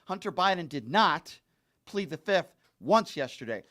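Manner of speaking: ordinary delivery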